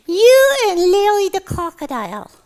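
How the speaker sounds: put-on high, loud childlike puppet voice; gleefully tattle in a squeaky puppet voice; high-pitched little tattletale; teasing puppet. Puppet teases the host.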